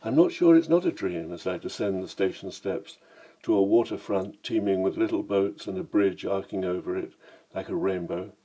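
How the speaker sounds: plain reading voice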